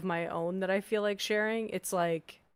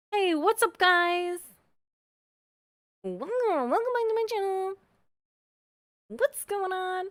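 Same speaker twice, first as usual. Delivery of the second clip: high, fast, bubbly vlog intro; YouTuber voice; 11 semitones higher, 5 dB louder than usual. Shown as a demonstration. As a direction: hype up the viewers. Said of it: "it goes high without me realizing".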